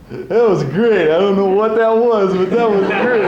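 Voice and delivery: high, loud goofy fan gushing; goofy character voice. Retold or imitated. Sam's character gushing over the reading. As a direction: gush like a dazzled know-nothing fan. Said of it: "likes to play a little character".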